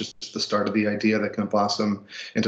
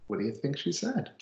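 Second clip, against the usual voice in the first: usual speaking voice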